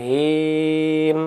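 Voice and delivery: হিম with the i held very long; three alif stretch. Shown as a demonstration. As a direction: chant the vowel long and even. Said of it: "খারা জেরটা তিন আলিফ".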